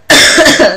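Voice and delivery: sharp loud cough. Real, not acted. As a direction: cough hard. Coughing from a cold.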